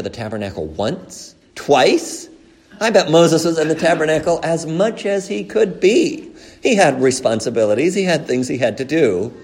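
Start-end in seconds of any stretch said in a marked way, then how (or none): 1.5-8.8 higher and louder pulpit emphasis; impassioned sermon line; emphatic preaching. Acted. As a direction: press the point home from the pulpit.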